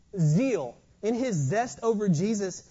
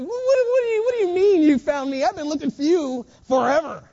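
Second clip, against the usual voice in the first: much higher and louder, sputtering disbelief; mock shocked cry at the talking treasure; flabbergasted; 6 semitones higher, 9 dB louder than usual. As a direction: sputter it out like a stunned treasure hunter. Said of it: "wouldn't you be a little flabbergasted".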